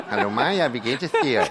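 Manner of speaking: lisping, laughing through it; slushy lisp. Retold or imitated. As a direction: imitate a lisping bee-keeper. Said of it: "Hat der gelispelt".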